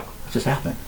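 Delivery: whispered. Acted. Puzzled characters, whispered aside.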